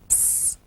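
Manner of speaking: "S." as a soft, voiceless hissed sss; just hiss the S sound; letter sound s. Shown as a demonstration. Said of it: "Now say it with me".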